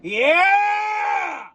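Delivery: high whooping yell; shout. Real, not acted. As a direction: let out a big victory shout. Celebrating after singing.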